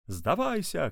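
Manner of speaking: high-pitched called-out demand; shouting through megaphone. A narrator's reading. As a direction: call on the fugitive to surrender. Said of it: "кричал папа в мегафон".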